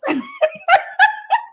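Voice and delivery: high shrieking laughter. Real, not acted. Cracks up at the joke.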